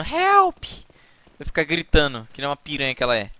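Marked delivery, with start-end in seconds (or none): 0.0-0.6 high-pitched imitated scream; mock scream for help. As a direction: shriek it like a helpless girl. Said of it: "pra ficar gritando".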